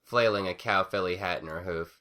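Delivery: usual speaking voice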